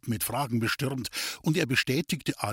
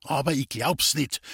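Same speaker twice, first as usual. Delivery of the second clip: plain reading voice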